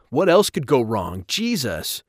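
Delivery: loud outburst, pitched high; exasperated exclamation. A narrator's reading. Rob scolded by his father, exclaiming in exasperation. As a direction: exclaim in frustration. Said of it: "Rob exclaimed".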